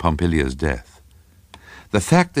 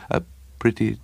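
higher, halting voice; breathless little man; 4 semitones higher than usual. A narrator's reading. Little man describing his position to Craven, halting and higher. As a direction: force it out in fragments. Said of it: "Sometimes the voice almost died in the throat".

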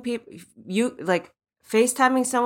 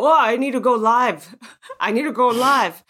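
dramatic go-live; 4 semitones higher, 6 dB louder than usual. Retold or imitated. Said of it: "I just go".

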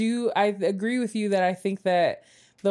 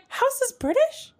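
pitched-up incredulous retelling; mock disbelief; 6 semitones higher than usual. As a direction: act stunned by the news. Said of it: "I was like".

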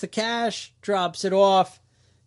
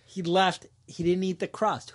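ordinary talk

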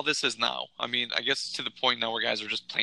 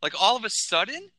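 heated rant; 6 semitones higher than usual. Acted. Continuing the rant.

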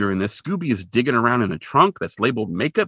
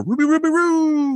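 Scooby. Retold or imitated. Podcaster acting Scooby racing to the rescue, high dog voice. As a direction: imitate a cartoon dog's garbled battle cry. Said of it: "Scooby races out on the bridge".

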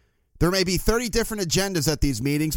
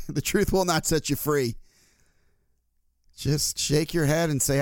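normal talking voice

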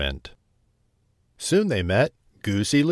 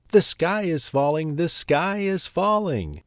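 crying out; 6 semitones higher than usual. A narrator's reading. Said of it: "cried Chicken Little".

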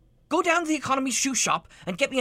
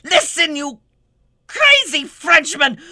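louder, fed-up insult; exasperated scolding; 4 dB louder than usual. Acted. Boss scolding his French waiter, fed up. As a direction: spit out the insult in frustration.

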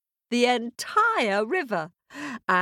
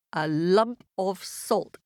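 ordinary delivery

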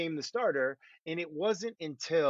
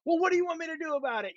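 much higher, fast, whiny comeback; defensive talkback; 14 semitones higher, much faster than usual. Retold or imitated. Quarterback mimicking his own defensive answers to the coach, fast and high. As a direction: snap back like a defensive young quarterback. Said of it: "And I'd be like".

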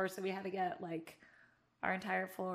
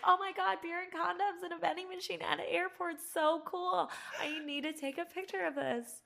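over-the-top delighted gasp; thrilled teen; 10 semitones higher than usual. Retold or imitated. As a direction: squeal it like a fourteen-year-old who found treasure. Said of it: "And I was like".

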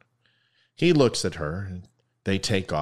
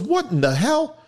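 high-pitched incredulous exclamation; mock outrage; 5 dB louder than usual. Man outraged in the joke.

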